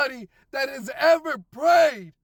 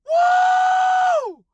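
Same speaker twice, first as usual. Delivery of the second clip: high, loud whoop of joy; joyful whoop; 5 dB louder than usual. Real, not acted. Celebrating a year sober on the ride home, big high whoop. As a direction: let out a big celebratory woo.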